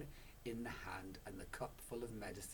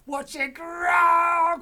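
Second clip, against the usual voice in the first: very high, very loud, very slow shout; Mister Cranky shouts in triumph, slow; 23 dB louder, much slower than usual. Acted. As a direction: let the cheer explode. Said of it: "cried Mister Cranky".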